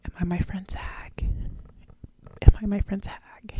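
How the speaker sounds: hushed whisper asking herself; whispered thought. Retold or imitated. Wondering if she's her friend's hag, whispered. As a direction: whisper the worry to yourself. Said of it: "I'm like".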